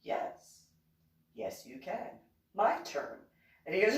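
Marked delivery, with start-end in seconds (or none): none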